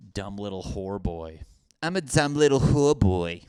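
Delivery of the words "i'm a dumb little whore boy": higher, louder put-on hooker voice. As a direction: repeat it as a hammed-up hooker. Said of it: "any hookers that sound like that?".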